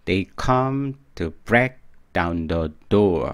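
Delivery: slow model reading. Shown as a demonstration. Teacher repeating the song line for learners, slow and deliberate. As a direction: draw each word out clearly.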